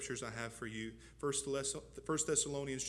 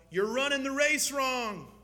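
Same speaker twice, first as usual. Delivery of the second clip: raised, higher heckling call; called out from the crowd; 8 semitones higher, 7 dB louder than usual. Retold or imitated. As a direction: shout it across to the runner. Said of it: "calls out".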